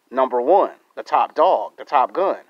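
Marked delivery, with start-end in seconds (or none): none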